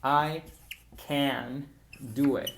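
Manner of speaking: "can" spelled out slowly and fully; slow, emphatic full can; slow, careful. Shown as a demonstration. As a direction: stretch out "can do it" deliberately. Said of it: "cuando hablamos lento para dar énfasis".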